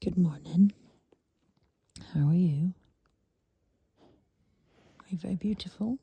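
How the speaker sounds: low, gentle cooing to a pet. Real, not acted. Cooing to the dog at dawn.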